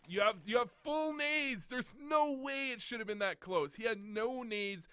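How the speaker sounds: high, drawn-out exasperation; exasperated protest. Real, not acted. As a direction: stretch the key words in disbelief. Coach exasperated by a near loss.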